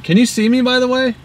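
ordinary talk, nothing marked

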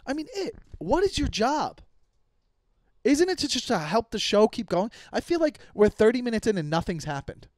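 high-pitched, incredulous questioning; exasperated. Real, not acted. Host needling the crew as the show stalls, exasperated. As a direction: push the pitch up in exasperated disbelief.

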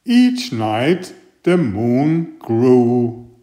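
ordinary delivery, nothing marked

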